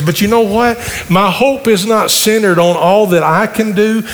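sermon preaching. Acted. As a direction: preach it to the congregation. Pastor preaching about the future.

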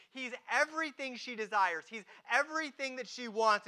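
usual speaking voice

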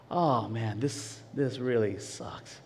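low, slow and soft dejection; glum grumbling. Retold or imitated. Patient stuck in the waiting room, low glum grumble. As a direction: sink into it, dragging the words.

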